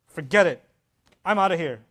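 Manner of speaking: loud, abrupt dismissal; ego-sparked huff. Acted. Offended counselor quitting the talk, loud huffy dismissal. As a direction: throw it out loudly and storm off. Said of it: "if your ego sparks and my ego sparks and we say".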